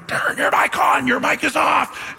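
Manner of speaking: mock urgent yell. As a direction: half-whisper half-shout from the floor. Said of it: "laying down on the ground going".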